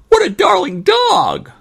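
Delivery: very high, slow squeal; girl crying out. Acted. Girl falling for a puppy, drawn-out high squeal. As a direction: gush loudly like a smitten little girl. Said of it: "cried the girl".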